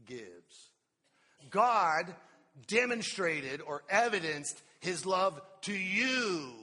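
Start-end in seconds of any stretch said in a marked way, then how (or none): 1.5-6.5 louder, slowed proclamation; preaching shout. Real, not acted. Preacher shouting to the congregation, slow and forceful. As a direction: proclaim it to the back row.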